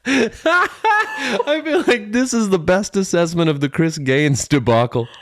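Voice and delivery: high, slow words through laughter; laughing while talking. Real, not acted. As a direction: talk through the giggles.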